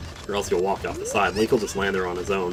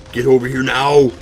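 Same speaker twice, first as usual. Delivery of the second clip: higher, louder action-hero voice; Arnold Schwarzenegger voice; 5 semitones higher, 8 dB louder than usual. Pretend. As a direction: bark it like a movie tough guy. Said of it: "talking like Arnold Schwarzenegger".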